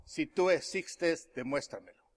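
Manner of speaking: bajo, lento, cayendo al final; reto íntimo a Dios. Retold or imitated. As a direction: decirlo despacio y cada vez más bajo, como oración a solas. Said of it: "le había dicho yo a Dios". (reto a Dios, en voz baja y pausada)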